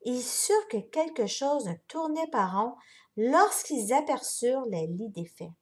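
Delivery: plain reading voice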